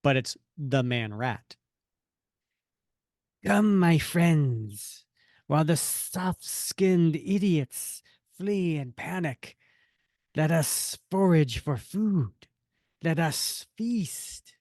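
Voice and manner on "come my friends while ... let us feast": very slow man-rat villain voice; Vermin. Acted. Rat villain calls his pack to feast, creature voice. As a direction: drag out each word like a sinister creature rallying its pack. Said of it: "that's how I did the voice".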